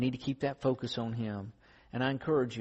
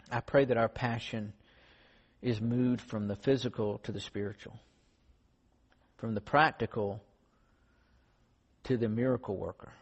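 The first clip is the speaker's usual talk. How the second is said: prayer. Acted. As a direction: pray humbly before the congregation. Closing prayer.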